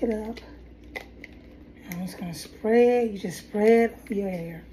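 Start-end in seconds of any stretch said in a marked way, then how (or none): none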